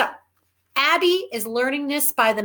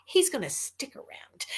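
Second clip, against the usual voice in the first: whispered aside; 3 semitones higher, 9 dB quieter than usual. Real, not acted. Sly aside about cat.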